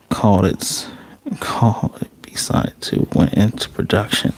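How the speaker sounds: hushed breathy whisper. Real, not acted. Youtuber sharing a casting tip, whispered. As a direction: let them in on a secret. Secret aside about casting.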